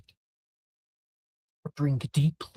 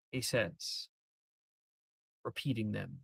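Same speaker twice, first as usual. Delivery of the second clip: ordinary delivery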